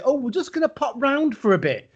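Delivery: high, breezy mock parent voice; imitating the in-laws. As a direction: lightly put on, sing-song in-laws. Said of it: "who say".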